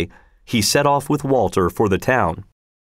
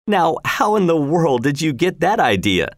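usual speaking voice

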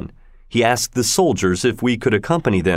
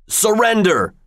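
shouted command; 11 semitones higher than usual. Acted. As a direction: bark it at the fighters. Soldiers break up fight.

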